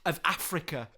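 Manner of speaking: sarcastic lyric recital; mock solemn recital. Mock quoting song lyrics.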